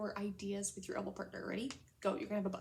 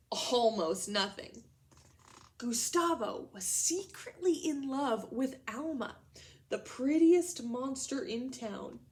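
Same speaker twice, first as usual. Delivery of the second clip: slow, loud, expressive storybook reading; dramatic read-aloud to children; story narration; 5 dB louder, slower than usual. A narrator's reading. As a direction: read it big and slow for the class. Reads the story aloud to class.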